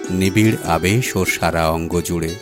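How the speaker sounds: plain reading voice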